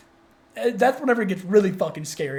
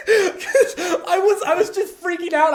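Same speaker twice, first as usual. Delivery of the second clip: laughing; 11 semitones higher, 4 dB louder than usual. Real, not acted. Mechanic explains his panic to a friend, laughing high. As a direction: laugh through the confession.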